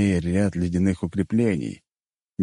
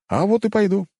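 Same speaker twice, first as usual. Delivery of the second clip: high-pitched playful retort; jokey; 14 semitones higher, 3 dB louder than usual. A narrator's reading. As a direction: toss it back teasingly, pitched high. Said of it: "Я шутливо поклонился". (шутливо, высоким голосом)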